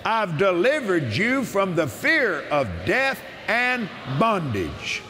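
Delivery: solemn preacher's proclamation; preaching scripture. Acted. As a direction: declare it as the voice of God himself, slowly.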